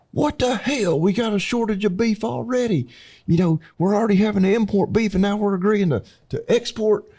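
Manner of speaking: high, exaggerated incredulous rant; mock outrage. Mimicking online commenters outraged over beef exports, mock outrage. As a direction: ham up the angry commenters' disbelief.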